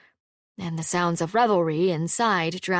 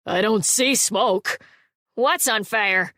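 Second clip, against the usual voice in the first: calling out; 3 semitones higher, 4 dB louder, slower than usual. A narrator's reading. Townsfolk calling out at night.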